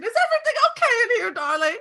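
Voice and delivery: put-on high, loud, fast old lady fuss; fussy old lady. Old lady rushes into the kitchen, high fussing voice. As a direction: flutter in like a worried granny. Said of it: "She runs to the kitchen".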